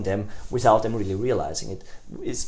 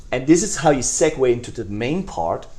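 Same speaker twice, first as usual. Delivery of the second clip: usual speaking voice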